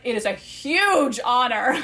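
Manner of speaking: slow, teasing grandness on the quote; drawn-out mock reverence. Retelling Cass teasing her, slow and mock-grand. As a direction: stretch it out with mock solemnity.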